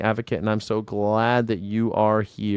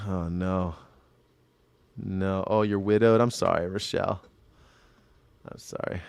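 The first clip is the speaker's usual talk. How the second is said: quiet, low sympathy; real hushed regret; soft condolence; 3 semitones lower, 8 dB quieter than usual. Real, not acted. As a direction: drop right down, apologetic and gentle. Learns the listener is widowed.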